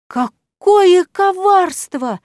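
high, loud, slow wail; wailing outcry. A narrator's reading. Cat professor wailing, high loud outrage. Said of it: "возопил кот".

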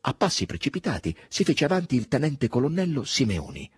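usual speaking voice

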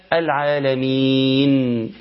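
العالمين chanted slowly, ending on a vowelless n; pausal sukoon ending. Shown as a demonstration. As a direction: drop the final "a" and hold العالمين's long "ee" into n. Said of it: "عند الوقف بيشيل الفتحة ويسكن".

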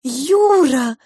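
high, loud call of a name; emotional cry. A narrator's reading. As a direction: cry out as if rushing into his arms. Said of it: "бросилась к нему младшая королевская кухарка".

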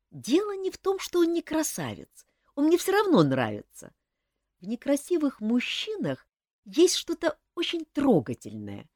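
put-on young woman Olga. A narrator's reading. Young woman voiced by narrator.

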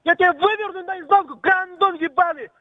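high, raging threats; furious shouting. Real, not acted. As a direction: yell it in fury. Furious threats in phone quarrel.

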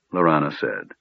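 usual speaking voice

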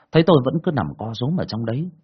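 ordinary delivery, nothing marked

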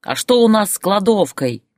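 high, angry demanding question; yelled. A narrator's reading. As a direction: yell it in alarm. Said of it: "опять заорал Борис". (орёт вопрос)

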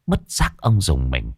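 usual speaking voice

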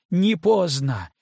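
high, loud desperate plea; shouting. Acted. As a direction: yell it at him, pleading. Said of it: "Кейт сорвалась на крик".

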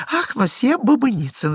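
high, chirping greeting; twittering lady. Acted. Lady twittering a greeting to Babynitsyn, high and chirpy. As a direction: twitter the name brightly. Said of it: "защепетала дама".

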